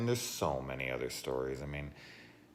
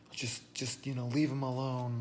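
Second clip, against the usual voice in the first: higher, whiny mock of scolding moviegoers; mock scolding crowd; 11 semitones higher than usual. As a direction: mimic prissy onlookers telling you off. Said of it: "They wanted to be like".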